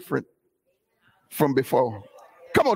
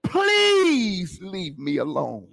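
long, stretched PLEASE, then falling away; pleading; much slower than usual. Retold or imitated. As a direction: plead slowly, almost crying, as the pushed man. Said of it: "he said".

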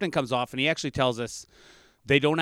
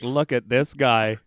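slow mocking; 5 dB louder, slower than usual.

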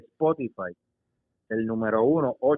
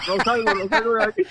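imitando a la señora; 7 semitones higher than usual. Retold or imitated. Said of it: "con el ojo aquí".

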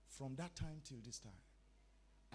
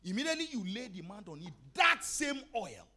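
rising and falling sermon cadence with loud peaks; full preaching; 2 semitones higher, 7 dB louder than usual. Acted. Preacher urging the congregation to stay hungry, full force. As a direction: preach it home to the church.

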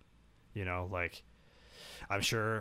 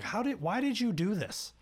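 exasperated; 11 semitones higher, 3 dB louder than usual. Retold or imitated. Confronting a miserable teacher.

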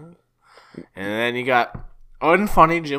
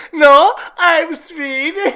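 high, loud, drawn-out character voice; put-on Swedish character; 13 semitones higher, 7 dB louder, much slower than usual. Retelling a movie gag to a co-host, hammed-up character voice. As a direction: play the foreigner, high and slow. Acting out a film character's reply.

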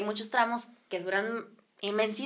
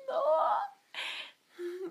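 horrified no; 6 semitones higher, 9 dB quieter than usual. Retold or imitated. Re-enacted horror at being left.

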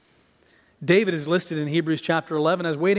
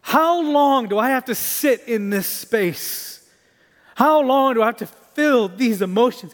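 slow, raised-pitch anguished plea; crying out to God; 5 semitones higher, 4 dB louder than usual. Acted. David pleading with God in lament, crying out slowly. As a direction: cry out the question, stretching the words. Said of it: "he's crying out to God and saying".

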